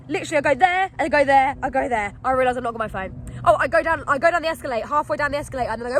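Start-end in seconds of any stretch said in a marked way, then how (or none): none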